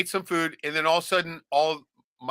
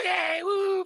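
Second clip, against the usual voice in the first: put-on much higher excited kid cheer. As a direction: squeal like happy kids. Kids cheering.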